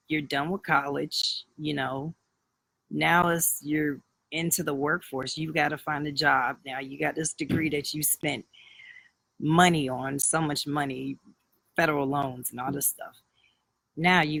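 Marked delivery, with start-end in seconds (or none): none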